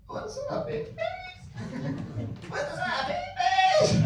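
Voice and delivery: strangled high croak, breaking into laughter; dry-mouthed croak. Acted. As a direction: try to scream through a parched mouth. Garbled dry-mouthed scream.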